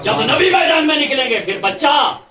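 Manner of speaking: high, loud shouted preaching; preacher shouting to the crowd, high and loud; fiery preaching. Real, not acted. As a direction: rise to the climax and shout it. Repeated climax shouted.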